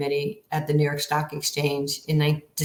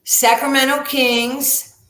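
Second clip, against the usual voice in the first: high, loud and slow, puffed up with pride; proud announcement; 8 semitones higher, 6 dB louder, slower than usual. Retold or imitated. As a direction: announce "Sacramento Kings" like a ring introduction. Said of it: "And I went".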